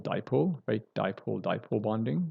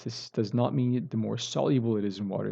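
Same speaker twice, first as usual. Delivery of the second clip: normal talking voice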